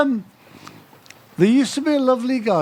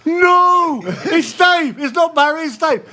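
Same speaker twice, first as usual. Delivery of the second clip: higher, louder exasperated shout; shouting at the radio; 9 semitones higher, 4 dB louder, slower than usual. Retold or imitated. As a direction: yell the correction at the radio. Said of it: "and I'm going".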